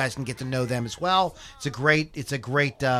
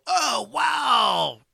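excited fan; 6 semitones higher than usual. Pretend. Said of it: "Would you go".

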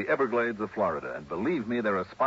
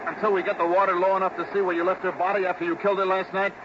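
calling out loud; 12 semitones higher, 5 dB louder than usual. Acted. Calling out over rushing water.

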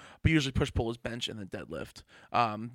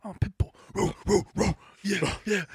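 tough pit bull; 6 semitones higher, 4 dB louder than usual. Retold or imitated. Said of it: "I'm like".

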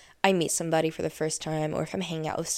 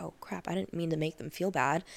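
much softer, murmured inner thought; hushed guilty realization; 8 dB quieter than usual. Retold or imitated. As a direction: say it under your breath as guilt sinks in.

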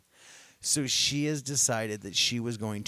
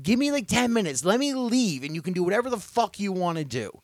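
angry dismissal; 7 semitones higher, 3 dB louder than usual. Retold or imitated. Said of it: "I said".

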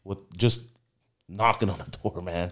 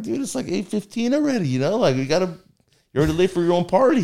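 high, hurried reminder; urgent; 8 semitones higher, faster than usual. Retold or imitated. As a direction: rush the late friend along, fast at the end. Telling friend he's late.

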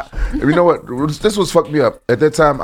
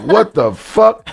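mock shock; 9 semitones higher, 3 dB louder, much slower than usual. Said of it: "And I was like".

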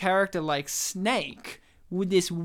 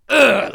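aggressive fighting grunt; loud, forceful kick grunt, imitating a game hero; Snake; 10 dB louder than usual. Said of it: "like, really aggressive".

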